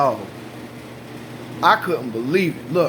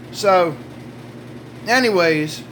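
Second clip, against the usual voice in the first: ordinary talk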